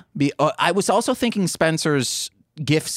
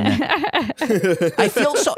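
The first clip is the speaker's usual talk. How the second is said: laughing; 10 semitones higher, much slower than usual. Real, not acted. Laughs mid-sentence.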